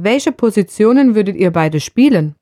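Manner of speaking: ordinary talk, nothing marked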